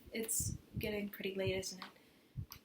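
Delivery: soft, low and tentative; quiet reluctance. Acted. Young woman to her date late at night, quiet and reluctant. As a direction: let it drop to a hushed question. Evening ending, reluctant remark.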